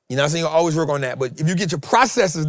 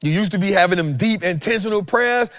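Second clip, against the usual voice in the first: usual speaking voice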